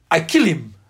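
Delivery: put-on thick Russian accent. Russian taxi driver answers a student's question, thick imitated Russian accent. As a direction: give the threat in a mock Russian accent. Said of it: "in his thick Russian accent".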